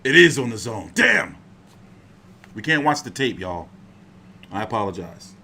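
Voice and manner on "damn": loud, high frustrated "damn"; frustrated outburst. Real, not acted. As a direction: blurt it out in real frustration. Finds out it's the zone tape.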